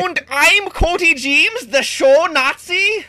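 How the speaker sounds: put-on slow, high, hammy German accent; booming bad German accent. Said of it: "very bad German accents".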